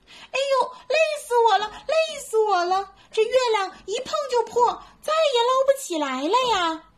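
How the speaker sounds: high, whiny little monkey complaint; fretful squealing. Acted. Little monkey can't catch the moon, high fretful whining. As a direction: squeal and whine in frustration. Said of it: "急得吱吱直叫唤".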